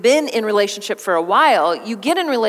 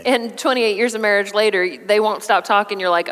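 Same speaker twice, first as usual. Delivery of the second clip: normal talking voice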